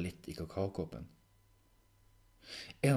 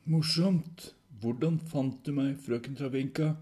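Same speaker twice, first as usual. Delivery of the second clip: loud, higher-pitched sarcastic retort; irritated repeat; 7 semitones higher, 6 dB louder, slower than usual. Acted. Proval brushing off Travinka's jibe, irritated retort. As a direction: dismiss the joke and press the question.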